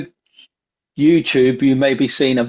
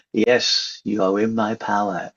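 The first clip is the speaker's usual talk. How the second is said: hypnotic voice; 2 semitones lower, 5 dB quieter than usual. Pretend. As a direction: lull them like a stage hypnotist. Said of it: "hypnotic voice".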